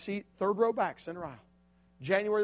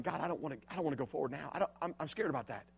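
much softer, hushed plea; scared bargaining; 4 semitones lower, 11 dB quieter, faster than usual. Retold or imitated. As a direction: confess the fear under your breath. Boy bargaining again, afraid.

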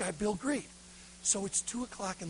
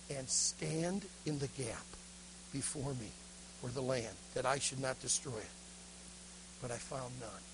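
low, soft, halting scripture reading; hushed reverent recitation; different, solemn reading; 5 semitones lower, 8 dB quieter than usual. Acted. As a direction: let the verse sink lower and softer, with pauses. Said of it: "but he read it differently".

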